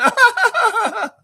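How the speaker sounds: loud, very high imitated cackle; exaggerated mock laughter; mocking fake laugh. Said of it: "She starts laughing".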